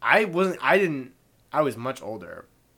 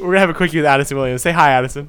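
radio host; 8 dB louder, faster than usual. Acted.

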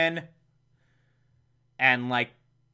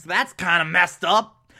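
usual speaking voice

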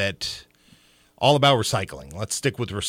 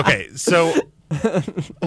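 laughing; 8 semitones higher, 6 dB louder, much slower than usual. Real, not acted. Still laughing after the robot joke.

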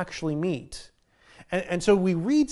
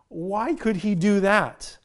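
usual speaking voice

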